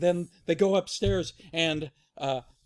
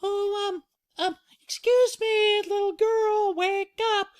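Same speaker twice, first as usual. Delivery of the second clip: very high falsetto, hesitant then louder; high polite motherly wake-up call; Mama Bear; 6 dB louder than usual. Acted. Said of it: "Mama Bear goes".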